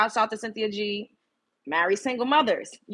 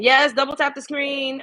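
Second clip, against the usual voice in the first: hyped call out; 6 semitones higher, 4 dB louder, slower than usual. Real, not acted. Urging stream viewers to tap.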